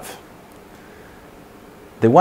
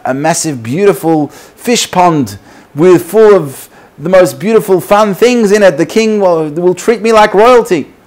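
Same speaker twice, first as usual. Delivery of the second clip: high, giddy hopes building louder; excited fish; 7 semitones higher, 4 dB louder than usual. Acted. Fish dreaming of royal treatment, increasingly excited. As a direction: get carried away, climbing higher as you dream. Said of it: "he gets so excited".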